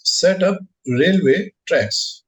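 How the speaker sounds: each word drawn out slowly; very slow dictation. Shown as a demonstration. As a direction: spell it out slowly for the class. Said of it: "you write the W B S name as".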